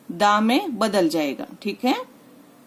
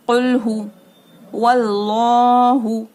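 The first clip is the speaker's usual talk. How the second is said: slow recited chant, louder; Quranic recitation; 5 dB louder, much slower than usual. Shown as a demonstration. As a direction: recite it slowly. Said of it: "अल्लाहु पढ़ते हैं".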